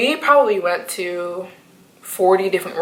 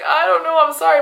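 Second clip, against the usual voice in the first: sheepish apology; 10 semitones higher, 3 dB louder than usual. Retold or imitated. Her sheepish reply.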